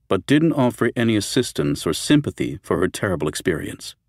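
usual speaking voice

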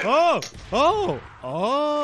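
real shocked yelps at a friend's mishap; startled cries. Real, not acted. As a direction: yelp in real alarm, three times. Friend's sudden fall, startled cries.

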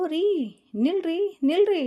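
wife calling to stop her husband, loud and high; urgent call. Acted. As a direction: shout at him to stop.

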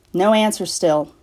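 plain reading voice

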